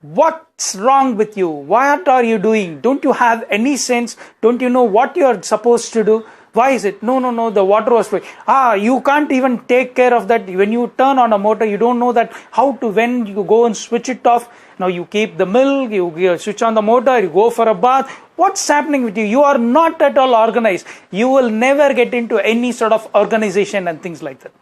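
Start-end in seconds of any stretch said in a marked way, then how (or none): 0.0-7.0 angry husband. Retold or imitated. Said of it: "Varun says". 7.0-8.3 quick, raised defensive excuse; flustered wife. Retold or imitated. Wife Varsha hurrying to explain the overflowing tank, raised. 8.5-23.9 loud, higher, rapid scolding tirade; angry husband. Retold or imitated. Husband Varun lecturing Varsha on her disorganisation, loud and fast.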